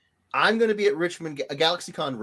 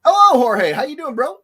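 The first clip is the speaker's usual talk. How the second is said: cheery greeting; 11 semitones higher, 11 dB louder than usual. Real, not acted. Greeting a chat viewer.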